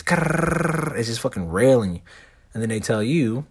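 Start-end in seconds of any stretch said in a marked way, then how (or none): 0.0-1.0 high rolled crackle; imitated bacon-grease sizzle. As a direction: trill it like spitting hot grease. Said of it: "It sounds like bacon grease".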